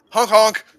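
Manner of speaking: high, loud honking noises; clown honking. Pretend. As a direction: honk like a clown horn. Clown honk bit.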